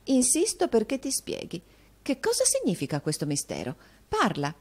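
theatrical demand, starting high and settling; high-flown, dramatic command to the maid. A narrator's reading. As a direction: insist melodramatically on an explanation. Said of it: "con tono drammatico".

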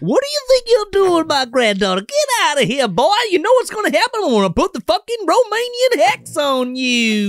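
host voicing Granny Van Dam threatening the boy; screeching old granny. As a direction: hiss the curse like a witch. Granny voiced by other host.

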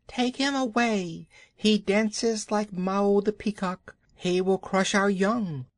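high-pitched protesting cobras; cobra voices. A narrator's reading. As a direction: protest in a high, put-on snake voice. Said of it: "said the cobras inside".

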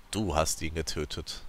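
low, soft and emotionless; calm, passionless. A narrator's reading. Gongora coldly accusing the narrator, calm and passionless. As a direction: say it coldly, without feeling. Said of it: "sagte er ruhig und vollkommen leidenschaftslos".

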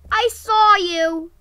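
loud, slow, high call; startled shout. Real, not acted. Gamer yelling at a spotted player. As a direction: catch them in the act.

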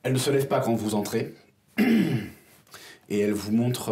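usual speaking voice

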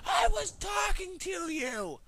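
very high, drawn-out wail of protest; hammed-up shriek at someone who left; mock outraged scream. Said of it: "and you're like".

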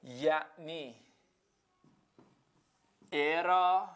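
drawn out, word by word; stretched syllables with a long pause; slow deliberate explanation. Shown as a demonstration. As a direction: spell it out very slowly for students. Teacher spells out the mnemonic's meaning.

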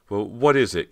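stammering, caught off guard; nervous stammer. A narrator's reading. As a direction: blurt it out flustered. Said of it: "Cody stammered".

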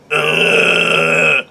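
long strained groan of discomfort; demonstrative backed-up groan; drawn-out groan. Shown as a demonstration. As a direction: groan it out as if all clogged up. Imitates how being backed up feels.

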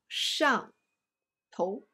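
上 then a pause then 头 stressed; 头 stressed, slowly split. Shown as a demonstration. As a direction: pull 上 and 头 apart, stress 头. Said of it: "头字要重读". (慢读上，头重读)

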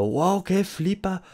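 high, drawn-out hyped wow; put-on over-amazed squeal; mock amazement. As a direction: exaggerate being blown away, without real volume. Mock amazed reaction, then downplayed.

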